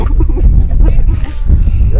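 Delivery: high jeering cackle; high mocking laugh. Crowd laughing at Grey Clothes, high jeering laugh. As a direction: laugh at the beaten man from up high.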